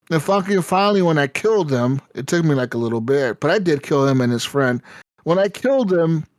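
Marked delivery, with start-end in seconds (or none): none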